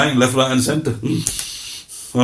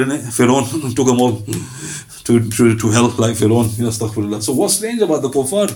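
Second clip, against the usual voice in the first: ordinary delivery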